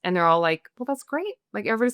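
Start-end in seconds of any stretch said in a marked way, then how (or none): 0.8-1.3 high, overly bright praise; imitating guests pushing enthusiasm; mock gushing approval; forced cheer.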